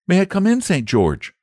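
put-on high, courteous young boy; polite child. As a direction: ask at the door nicely. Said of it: "said the boy politely".

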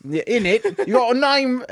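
high, loud Cockney caricature, laughing; mock British accent. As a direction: ham up a British accent through giggles. Mock British version of the line.

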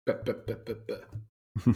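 soft choppy buh sounds; imitating a breaking-up line. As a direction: mimic a signal cutting out. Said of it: "but it's like".

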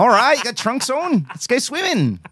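very high, hammed-up Aussie voice; exaggerated Australian accent. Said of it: "there's some Australian accent".

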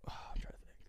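whispered under the breath; a barely voiced whisper to oneself; thinking aloud. Real, not acted. Trying to think of an example, whispering to self.

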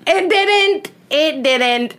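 high, drawn-out denial; emphatic repetition. Real, not acted. Mock-emphatic echo of co-host.

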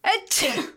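high quick mock sneeze; fake sneeze. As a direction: sneeze it out playfully. Sneeze from bird allergy.

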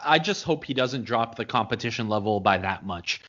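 usual speaking voice

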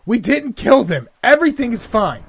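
yelling. Acted. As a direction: yell the denial after her.

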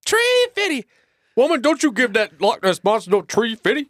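high put-on scolding voice; hammed-up husband telling off his wife; comic character bit. Husband scolds woman about paying Loch Ness Monster, high mock scolding.

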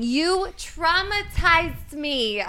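high, drawn-out reality-show whine; slow, high, hammed-up complaint; Sammy Sweetheart. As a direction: stretch each word in a Jersey put-on. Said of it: "I always think of Sammy Sweetheart going".

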